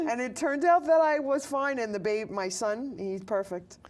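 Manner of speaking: ordinary talk, nothing marked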